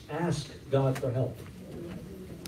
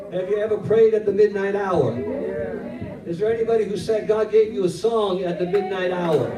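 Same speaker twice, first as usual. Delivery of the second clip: louder, higher preaching; full-force preaching; 3 semitones higher, 4 dB louder than usual. Real, not acted. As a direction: preach it to the rafters. Preaching climax.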